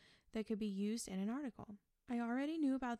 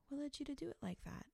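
soft, higher-pitched, quick curious question; soft unassuming curiosity, voice lifted and quiet; gentle, unassuming, curious; 5 semitones higher, 5 dB quieter, faster than usual. Shown as a demonstration. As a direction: ask lightly and gently, with no judgment. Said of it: "And a gentle, unassuming, curious voice".

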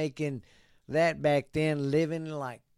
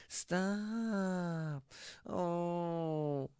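high, drawn-out imitation, softly held; mock squeaky voice, stretching each word; Stewart from Mad TV; 5 semitones higher, 5 dB quieter than usual. Host mimicking the woman in the video, squeaky character impression. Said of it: "she sounds like Stewart from Mad T V".

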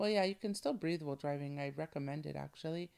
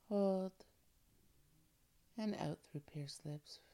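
guided breathing; 3 dB quieter than usual. Acted. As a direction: calmly guide each breath. Leads breathing exercise.

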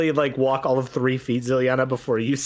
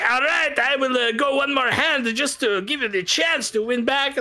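higher, smooth gambler offering another hand; generous gambler; 10 semitones higher than usual. Acted. Gambler offering the girl one more hand, high smooth voice. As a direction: sound magnanimous, luring her back in. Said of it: "Philip is like".

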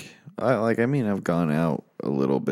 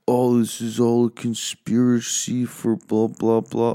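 mocking skeptics; 3 semitones higher, 4 dB louder than usual. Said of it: "some people might just think".

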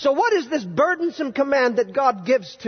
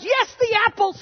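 sudden high, teasing lift; a playful higher voice on the apples; playful emphasis; 10 semitones higher than usual. Acted. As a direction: tease the crowd about the apples. Playful aside on the forbidden apples.

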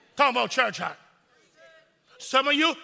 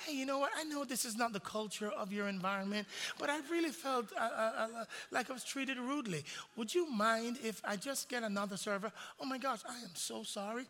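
much softer, overly polite and fragile; mock sweet complaint; 14 dB quieter than usual. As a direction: play the delicate wronged customer. Customer and manager voiced softly.

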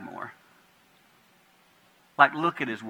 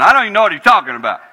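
laughing denial; 5 semitones higher, 13 dB louder, much faster than usual. Retold or imitated.

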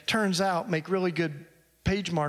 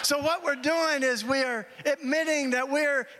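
higher, fervent preaching voice; 8 semitones higher than usual. Acted. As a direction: lift it up like the sermon's climax. Preaching at full force.

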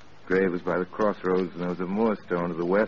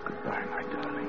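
breathless whispered goodbye, much softer; whispered farewell; 8 dB quieter than usual. Acted. Frank whispering a last goodbye to Megan, softly. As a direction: let the goodbye fall to a whisper.

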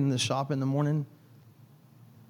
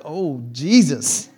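mock fear; 4 dB louder, much slower than usual. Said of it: "And I'm like".

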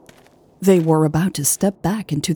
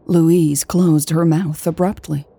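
ordinary delivery

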